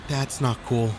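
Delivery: rising alarm, sudden swearing; startled and alarmed. Acted. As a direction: caught off guard, getting scared. Stuck in traffic, chaos starts.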